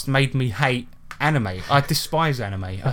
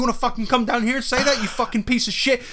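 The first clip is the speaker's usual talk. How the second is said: much higher, furious mock rant; angry yell; 10 semitones higher than usual. Furry in a dragon suit yelling up at Gary, furious. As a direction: rage at the heckler in the window. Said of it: "he's like".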